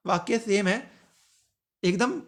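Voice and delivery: normal talking voice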